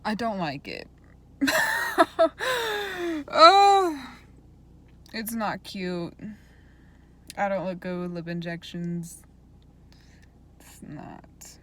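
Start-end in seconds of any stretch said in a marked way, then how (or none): 1.1-3.2 big high burst of laughter; loud laugh. Real, not acted. Laughing at own swollen lips. 3.3-4.0 loud, high exclaimed oh. Real, not acted. Reacting to own swollen lips. 10.6-11.6 unvoiced whisper aside. Real, not acted. Muttered aside about lips.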